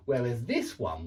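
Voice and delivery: usual speaking voice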